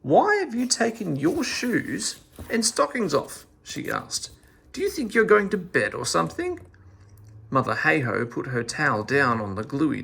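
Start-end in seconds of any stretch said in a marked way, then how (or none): 0.0-3.5 high, astonished question. A narrator's reading. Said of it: "She was most astonished". 4.7-6.6 high, quick mocking question; astonished. A narrator's reading. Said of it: "She was most astonished".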